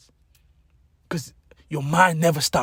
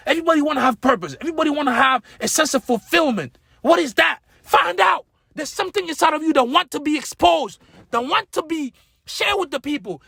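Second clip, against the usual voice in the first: high, loud rousing preacher voice; preaching at full force; 9 semitones higher, 3 dB louder than usual. Acted. Motivational speaker fires up audience, rousing preaching. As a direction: whip the crowd up, pitch climbing.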